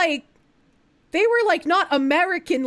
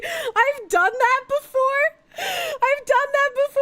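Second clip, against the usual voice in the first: laughing; 11 semitones higher, slower than usual. Real, not acted. Laughing confession while talking.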